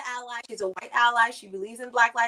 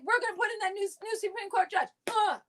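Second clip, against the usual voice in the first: mock Republican boast; 9 semitones higher than usual. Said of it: "The Republicans are like".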